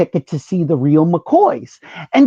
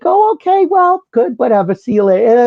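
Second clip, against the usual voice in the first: much higher, quick dismissive okay; brushing off; 6 semitones higher than usual. Retold or imitated. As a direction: try to shake him off politely. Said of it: "And she's like".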